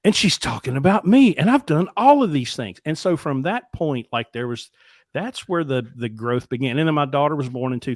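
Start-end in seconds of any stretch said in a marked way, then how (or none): 0.0-2.8 higher, louder burst of dawning shock; retold panic of self-recognition; stunned realization. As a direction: hit the realization with alarm.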